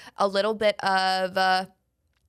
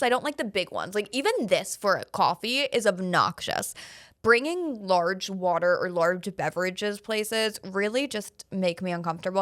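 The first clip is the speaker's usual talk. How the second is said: normal talking voice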